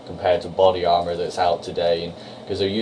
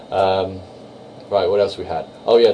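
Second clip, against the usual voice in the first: ordinary talk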